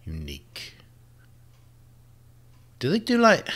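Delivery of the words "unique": whispered, barely voiced. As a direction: breathe the word out like a secret. Whispered word after pause.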